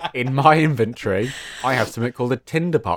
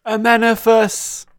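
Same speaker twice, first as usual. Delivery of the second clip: drawn-out, much higher, loud call of the name; Mummy reference; 11 semitones higher, 7 dB louder, slower than usual. Pretend. Player quoting The Mummy, slow loud chant. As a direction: slowly intone it like a film incantation. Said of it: "that was a really deep, uh, Mummy reference".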